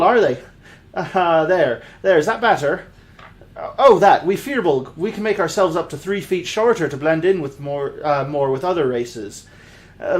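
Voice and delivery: lightly put-on rustic Irish-ish accent for a Firbolg paladin; hammed-up homespun accent for a gentle giant. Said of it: "lack thereof of that accent".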